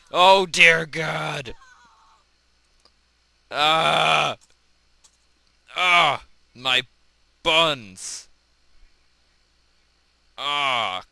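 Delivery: slow, high, loud anguished shouting; hammed-up death screams. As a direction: howl like a cartoon victim. Character dying in game.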